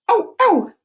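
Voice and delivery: high, loud yapping ow ow; French dog bark. Shown as a demonstration. Teacher barking the French dog sound. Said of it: "in French, people say that dogs say".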